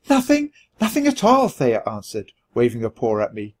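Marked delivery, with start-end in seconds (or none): 0.1-1.5 feigned innocence. A narrator's reading. Sister hides something, feigns innocence.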